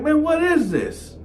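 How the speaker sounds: high, stunned question; can't believe what he's reading; shocked disbelief. Retold or imitated. Narrator reading the charges, high and stunned.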